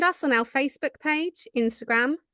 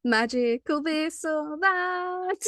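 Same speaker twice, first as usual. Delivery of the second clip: slow, drawn-out game show host; 4 semitones higher, much slower than usual. Acted. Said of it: "I put on my game show voice".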